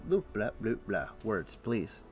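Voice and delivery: ordinary delivery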